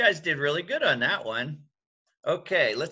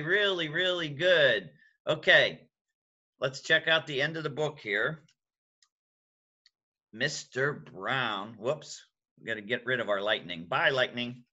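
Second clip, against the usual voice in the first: usual speaking voice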